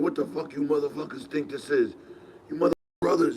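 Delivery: imitating a furious older man yelling; mock angry scolding. Joe P scolding the boys, mock yell. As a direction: yell at the kids like Joe P did. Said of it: "you know how Joe P used to talk".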